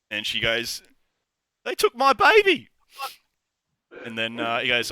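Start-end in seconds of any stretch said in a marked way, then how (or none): 1.6-2.7 hammed-up high, loud wail; crying wail. Shelley's mock-tearful cry to the tavern owner, wailing. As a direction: wail it out as a distraught mother. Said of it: "starts crying".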